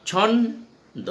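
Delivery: ছন্দ pulled apart syllable by syllable for a class; split into syllables. Shown as a demonstration. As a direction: say ছন্দ very slowly, broken after ছন. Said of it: "উচ্চারণ করছি আমরা".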